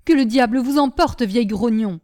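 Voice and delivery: loud outburst, patience gone; exasperated cry. A narrator's reading. Brigadier cursing grumbling Nanon, exasperated. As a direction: explode at the old grumbler. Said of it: "s'écria le brigadier à bout de patience".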